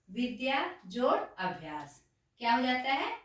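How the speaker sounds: ordinary delivery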